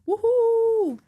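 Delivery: high, loud woohoo; excited cheer. Real, not acted. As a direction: cheer it out like a hype crowd. Cheering the new episode.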